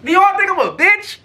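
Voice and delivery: high-pitched indignant challenge; angry outburst. Retold or imitated. Storyteller rants at the scammer who took the money, angry and incredulous. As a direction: demand it, voice climbing on every question. Furious ranting at the absent scammer.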